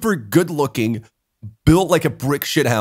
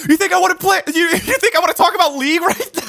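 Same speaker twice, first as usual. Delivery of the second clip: laughing exasperation; faster than usual. Retold or imitated. Retelling his friend's outburst about League, laughing hard. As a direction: try to do the angry friend but crack up.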